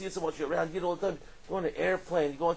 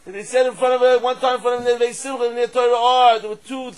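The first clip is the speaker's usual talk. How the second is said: preaching at full force; 7 semitones higher, 17 dB louder than usual. Acted. Rabbi urging everyone in this room to greatness, shouting fervently. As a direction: rouse the whole room to desire.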